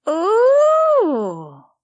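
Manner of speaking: high, loud dismayed oh; surprised, disappointed. Acted. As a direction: gasp at the shabby toy. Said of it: "surprised and disappointed".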